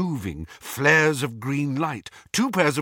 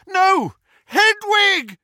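anguished cry; 8 dB louder, much slower than usual. Acted. Owl falls, Harry screams.

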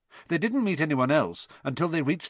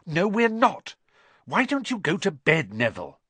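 brushing-off Hermione; 6 semitones higher, 3 dB louder than usual. A narrator's reading. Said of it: "said Hermione".

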